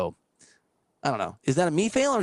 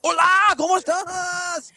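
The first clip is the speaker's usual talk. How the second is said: high, loud, drawn-out shout; mock stage greeting; 4 dB louder, much slower than usual. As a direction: holler a hello like a comic opening a show. Imitates comedian greeting the crowd.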